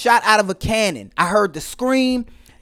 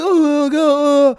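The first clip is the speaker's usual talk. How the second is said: higher mock-panicked wail; imitated panic; 6 semitones higher than usual. Husband imitating his panicking wife on the steps, high mock wail. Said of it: "and panicking".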